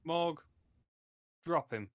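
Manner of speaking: normal talking voice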